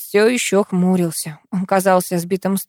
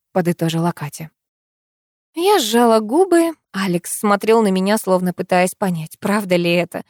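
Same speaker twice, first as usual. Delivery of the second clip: ordinary delivery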